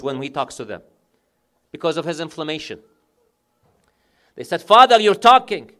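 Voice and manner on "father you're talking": "Father, you're talking" cried out loud, higher, in amazement; loud astonished cry. Acted. Daughters crying out that their mute father speaks, loud and astonished. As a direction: shout the miracle to the hall. Repeated as the climax of the miracle.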